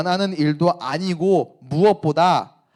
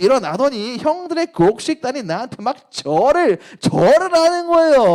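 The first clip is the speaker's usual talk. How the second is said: put-on higher, louder, drawn-out boastful kid voice mixing English; cocky Joseph; 5 semitones higher, 6 dB louder, slower than usual. Joseph brags about his dream to his brothers, loud and high. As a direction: show off to the brothers, loud and slow. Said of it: "이 꿈을 형들한테 썰로 푸는 거예요".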